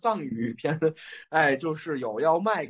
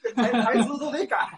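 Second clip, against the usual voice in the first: laughing through the words; chuckling as she talks; 10 semitones higher than usual. Real, not acted. Laughing while retelling a mishearing.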